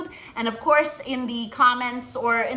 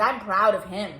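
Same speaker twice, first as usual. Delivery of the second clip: normal talking voice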